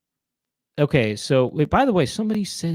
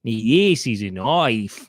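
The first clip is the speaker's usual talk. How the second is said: put-on drawn-out Australian accent; hammy Australian vowels, slowly; broad mock-Australian drawl; Paul Hogan; 4 semitones lower, much slower than usual. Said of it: "This is my impression of, uh, Paul Hogan".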